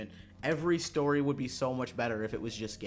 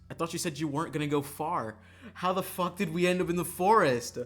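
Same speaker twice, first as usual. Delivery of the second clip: loud, higher-pitched exasperation; exasperated complaint; 6 semitones higher, 8 dB louder than usual. Acted. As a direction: demand an answer, louder and higher. Acted complaint to the princess.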